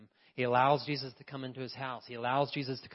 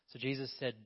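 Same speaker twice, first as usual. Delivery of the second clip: ordinary talk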